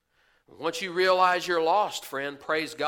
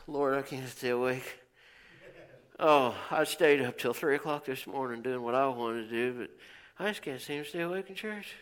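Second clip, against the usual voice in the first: sleepy excuse; 6 semitones lower, 7 dB quieter than usual. Acted. Voicing a sleepy worshipper's excuse.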